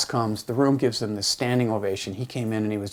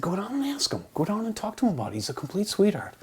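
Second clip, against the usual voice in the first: higher and fast, bubbling encouragement; eager urging; 10 semitones higher than usual. Retold or imitated. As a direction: push him warmly to go ask. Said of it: "I said".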